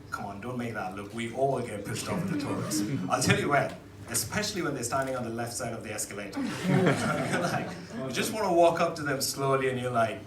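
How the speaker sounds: usual speaking voice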